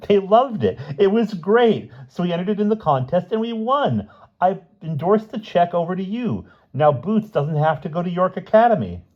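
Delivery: high hysterical gushing. A narrator's reading. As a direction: gush it out, still hysterical. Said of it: "still hysterical".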